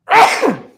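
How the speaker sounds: loud sudden sneeze; a big real sneeze bursting out; sneezing. Real, not acted. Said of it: "I'm gonna sneeze".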